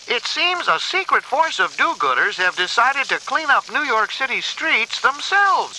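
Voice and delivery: lightly voiced TV reporter. A narrator's reading. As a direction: present it like breaking news. April reads a news bulletin.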